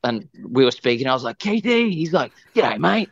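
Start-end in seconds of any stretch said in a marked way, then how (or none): 1.4-1.9 very high-pitched call of a nickname; mock chummy greeting. Retelling how he hailed [name], mock chummy greeting. 2.5-3.1 high, hammed-up Aussie greeting. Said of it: "went full Australian".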